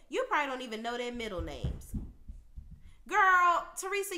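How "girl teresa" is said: exasperated shout. Real, not acted. Venting at Teresa on camera, loud exasperated girl.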